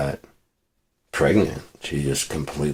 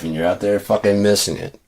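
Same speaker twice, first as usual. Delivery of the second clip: ordinary talk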